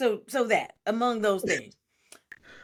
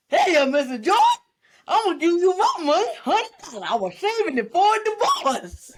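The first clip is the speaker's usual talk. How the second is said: high, loud put-on voice of the maid; mocking maid voice; 7 semitones higher, 5 dB louder than usual. Mocking the movie maid to the co-host, high put-on voice.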